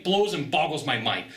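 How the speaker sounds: ordinary delivery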